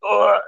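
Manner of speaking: mock groan of nausea. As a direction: groan like you're about to puke.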